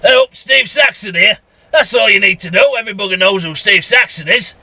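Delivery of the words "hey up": usual speaking voice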